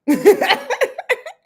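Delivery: high, loud laugh; real laugh bursting out high; burst of laughter. Real, not acted.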